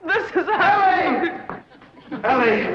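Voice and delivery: high, slow, laughing cry of delight; lovestruck rapture. Acted. Charmed Ellie greets her suitor, high laughing delight. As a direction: swoon out her name in giddy joy. Bewitched girl gushes at suitor.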